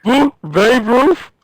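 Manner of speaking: high, hammed-up Sloth yell; Sloth impression. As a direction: bellow it like Sloth from the Goonies. Imitating Sloth from the Goonies.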